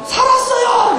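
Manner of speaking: high, drawn-out schoolkid yell; mimicked kid's shout. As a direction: imitate one student crying out the answer. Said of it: "그 음성이 내 귀에 지금". (학생 흉내 내며 높게 외치기)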